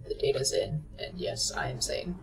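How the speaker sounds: whispered mock reassurance; whispering. Real, not acted. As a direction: breathe it conspiratorially. Jokey sanity claim.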